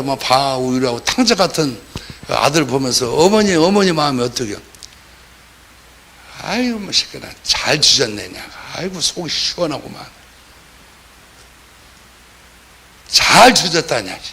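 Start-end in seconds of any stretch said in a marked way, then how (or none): 6.4-14.2 lightly voiced bitter, relieved parent; mock gloating mother. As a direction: play a mother glad her wayward son got what he deserved. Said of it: "그렇게 생각할까요?".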